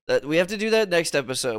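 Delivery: ordinary talk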